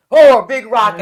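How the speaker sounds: mock alarm. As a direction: yell it in hammed-up panic. Acting out panic in an earthquake.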